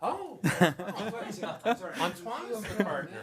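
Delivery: laughing through an apology at the mix-up; sheepish giggle over the mistake; embarrassed laugh. Real, not acted.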